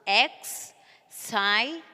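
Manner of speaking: "excited" as ex... sigh... ted; three separate beats on "excited" with clear pauses; syllable by syllable. Shown as a demonstration. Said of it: "It will becomes".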